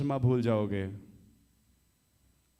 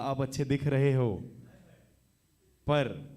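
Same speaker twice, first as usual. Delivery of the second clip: ordinary talk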